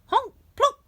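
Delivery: goose honk. A narrator's reading. Goose sound effect.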